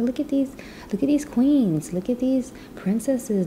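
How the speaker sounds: gaze up at them, marvelling; admiring awe. Retold or imitated. Admiring hijabi sisters in public.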